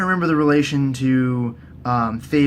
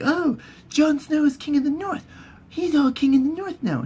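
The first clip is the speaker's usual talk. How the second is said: Arya; 11 semitones higher than usual. Retold or imitated. Arya hearing Jon is King, high mock surprise. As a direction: pitch it way up, lightly amazed. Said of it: "she's all like".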